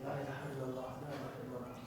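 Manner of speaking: very soft, low murmured prayer; hushed, repeated devotion; quiet recitation. Retold or imitated. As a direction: recite privately under your breath. Plotter reciting alone in his room.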